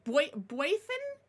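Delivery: put-on "Boythin" with "boy" as its first syllable; first name guess. Shown as a demonstration. Streamer checking a viewer's name, tentative pronunciation of "Boythin". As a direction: try "Boythin" slowly, the first vowel as in "boy". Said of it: "if I'm pronouncing your name right".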